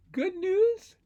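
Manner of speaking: high, loud, lifting exclamation; excited good news. Acted. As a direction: sing out the happy news. Happy story announcement.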